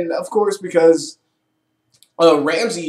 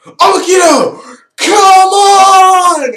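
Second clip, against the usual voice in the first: much higher, much louder battle shout; heroic yell; 13 dB louder, much slower than usual. Retold or imitated. Ramses summons his robo dinosaur, heroic shout. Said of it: "he just kind of goes".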